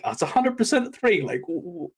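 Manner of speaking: high-pitched mock disbelief; incredulous retelling. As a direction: replay your baffled reaction, voice jumping up high. Retold shocked reaction to three count.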